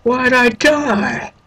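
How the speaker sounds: high, slow baffled cry; baffled whine. Retold or imitated. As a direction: stretch it out, bewildered. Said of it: "I'm like".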